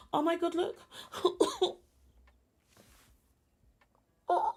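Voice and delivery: high squeaky cooing over a tiny chick. Real, not acted. Cooing at her new chick in the game, high baby talk.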